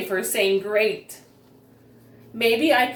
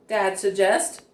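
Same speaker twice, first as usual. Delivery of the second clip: usual speaking voice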